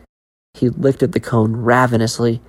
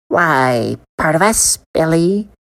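a touch higher, casual vendor; matter-of-fact; 6 semitones higher, slower than usual. A narrator's reading. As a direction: say it like it's obvious. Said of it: "matter-of-factly".

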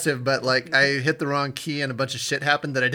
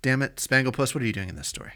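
ordinary delivery

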